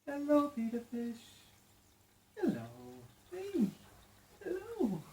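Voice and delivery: put-on high sing-song baby voice for the fish; pet talk. Owner greeting her new fish in high, sing-song pet talk.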